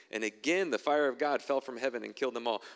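normal talking voice

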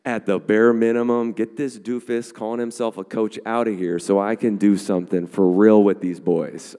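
loud, worked-up imagined rant at the coach; mock rant. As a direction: rant like a fan fed up with the coach.

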